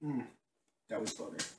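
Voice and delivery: hushed whisper. Real, not acted. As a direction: breathe it out in a whisper. Quiet verdict after gross bean.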